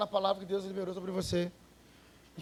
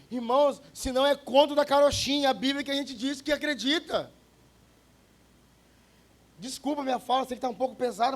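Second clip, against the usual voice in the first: raised, pressed pulpit voice; fiery preaching; 5 semitones higher, 5 dB louder than usual. Acted. Preacher confronting the congregation about their faith, forceful preaching. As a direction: drive the point home to the church.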